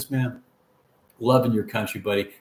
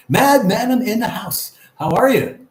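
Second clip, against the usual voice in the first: slow, much higher and louder hello; excited greeting; 9 semitones higher, 6 dB louder than usual. Real, not acted. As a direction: announce the viewer like a show host. Greeting a chat viewer.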